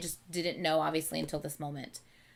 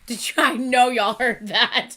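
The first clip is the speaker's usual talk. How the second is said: laughing; 6 semitones higher, 12 dB louder than usual. Real, not acted. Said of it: "That sounded like a ghost".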